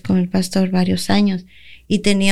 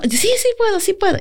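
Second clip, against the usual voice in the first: mock eager yes, pitched far higher than her usual voice; imitating her eager younger self; eager yes I can; 12 semitones higher than usual. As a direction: chirp it out like a keen newcomer. Her retold eager reply.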